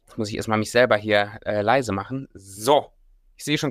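ordinary talk, nothing marked

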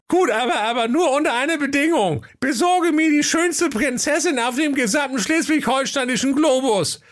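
squeaky prince, shrewd and demanding; a sly little prince laying down terms; high squeaky prince. Acted. Voices Hacke Peter. (piepsiger, gewitzter Prinz)

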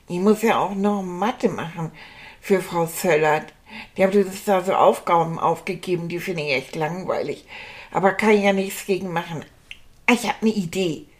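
ordinary delivery

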